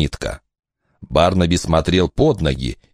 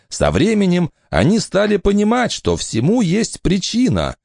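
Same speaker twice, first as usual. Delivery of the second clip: higher, lively woman's voice; mother's voice; 11 semitones higher than usual. Acted. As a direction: speak up bright as the mother. Eleanor persuades.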